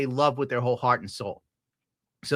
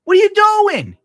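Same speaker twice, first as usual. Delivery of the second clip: much higher, louder frantic shout; retold yelling; 9 dB louder than usual. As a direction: yell at the girlfriend in the street. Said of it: "And I'm like".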